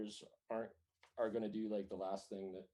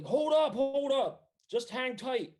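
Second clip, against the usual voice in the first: very high, loud holler; urgent calling out; 8 dB louder than usual. Acted. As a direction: shout for everyone to wait, pitched way up. Enemy calls out to halt.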